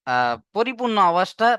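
ordinary delivery

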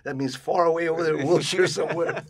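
high, loud, slowing imitation of dad; hammed-up father giving directions; mock dad. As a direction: wave it off vaguely, loudly. Translating dad with same gusto.

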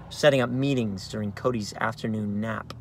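ordinary delivery, nothing marked

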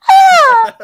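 high, loud shriek; mock scream. Paige getting hit by the shillelagh, a high hammed-up shriek. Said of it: "she's like".

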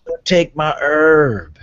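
slow, drawn-out plea; whiny stoner voice. Acted. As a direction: beg slowly in a silly voice.

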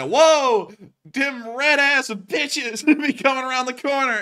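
high, loud drawn-out mock alarm; alarmed lookout. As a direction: call out a hammed-up warning. Acts Paul Revere spotting the British.

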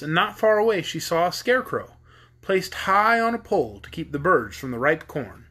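plain reading voice

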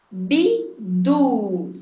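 BIDU split as Bi, du, loud; syllable by syllable. Shown as a demonstration. Teacher reading syllables aloud to children, Bidu split in two. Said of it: "Falar a sílaba em voz alta".

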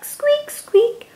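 very high tiny squeak; mouse squeaks. Acted. Reading to a child, squeaking like a tiny mouse.

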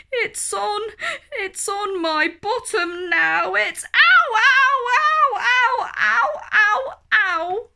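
high, loud ow, ow, ow; hammed-up child crying out in pain; child's pained cries. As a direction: yelp with each sting. Child stung on the bottom.

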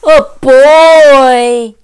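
very loud, drawn-out yell; bear's yell. A narrator's reading. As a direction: yell it out in alarm as the bear. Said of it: "yelled the bear".

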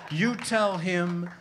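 put-on cheeky Liverpool accent that rises to a loud finish. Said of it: "in his best accent".

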